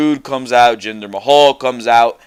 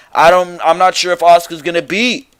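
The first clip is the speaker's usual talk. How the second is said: ordinary talk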